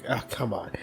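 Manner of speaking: muttered groan. Retold or imitated. Muttering at a coworker who missed the pun, soft deflated groan. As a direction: sink into it, beaten by her. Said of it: "I was like".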